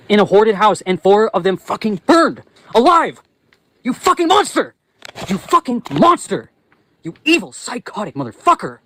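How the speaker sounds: real furious outburst, pitched high; enraged. Real, not acted. Vlogger condemning someone over burned dogs, enraged. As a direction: let raw rage pour out, stretching key words. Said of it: "I need to stop yelling".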